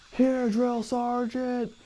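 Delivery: high, drawn-out recruit's call; recruit answering. Retold or imitated. Recruit sounding off in roll call.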